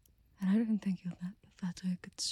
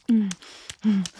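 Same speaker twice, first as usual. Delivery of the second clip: ordinary delivery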